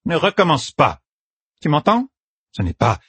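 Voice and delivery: high, urgent scolding; sharp high scolding. A narrator's reading. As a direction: snap at him to stop, pitched high. Katsune scolds the boy sharply.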